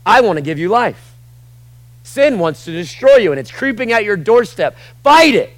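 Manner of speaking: loud, high, driving sermon voice; preaching at full force. Acted. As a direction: hammer it home to the church.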